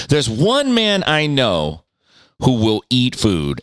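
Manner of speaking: ordinary talk, nothing marked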